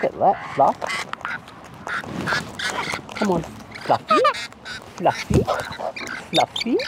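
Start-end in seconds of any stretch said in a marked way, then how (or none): 3.8-4.2 high sing-song call. Real, not acted. Calling bird by name.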